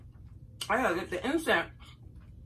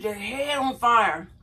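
slow announcement; slower than usual. Retold or imitated. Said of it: "She said".

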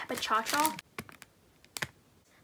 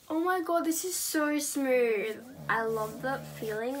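normal talking voice

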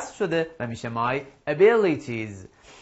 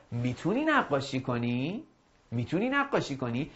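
ordinary talk

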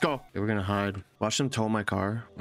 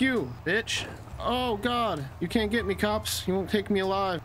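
high-pitched gleeful taunts at the cops; giddy getaway taunting; excited taunting; 10 semitones higher, slower than usual. Real, not acted. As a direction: crow at the police as you flee. Taunting police during getaway.

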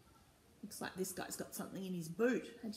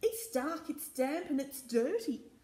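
put-on high child voice; 6 semitones higher than usual. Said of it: "said Ted".

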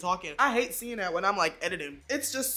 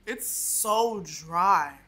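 slow, drawn-out; 4 semitones lower, much slower than usual. Real, not acted. Drawn-out complaint about biscuit.

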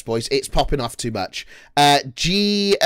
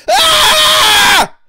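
high, loud, sustained scream; test yell; 13 dB louder than usual. Shown as a demonstration. Streamer tests his mic level, long loud yell. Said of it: "I gotta yell".

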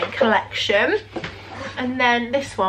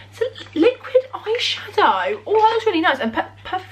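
excited; 9 semitones higher than usual. Real, not acted. Delight at an advent gift.

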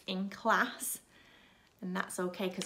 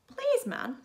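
much higher, louder pig voice; high piggy plea; little pig voice; 6 dB louder than usual. Acted. Third pig asks for bricks.

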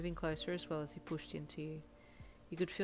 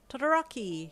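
loud, high excited greeting; thrilled to see him; 8 semitones higher, 9 dB louder than usual. A narrator's reading. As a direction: call his friend's name with delight. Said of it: "he said excitedly".

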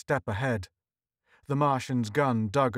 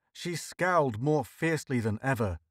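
plain reading voice

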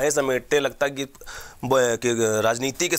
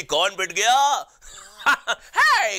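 cartoon laugh; 7 semitones higher, much slower than usual.

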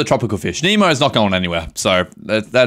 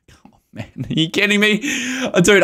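normal talking voice

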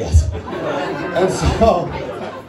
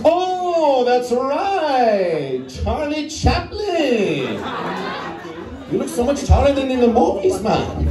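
high, slow delighted discovery; light up as if you finally place the face; delighted recognition; 7 semitones higher, much slower than usual. Acted. Passerby's mistaken recognition.

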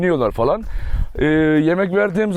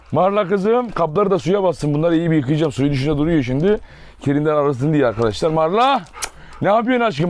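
ordinary talk

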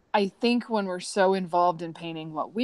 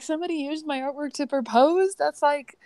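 higher, bright amazed exclaim; delighted disbelief; 8 semitones higher than usual. Retold or imitated. Artist hearing her art sparked a proposal, high delighted amazement. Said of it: "I'm like".

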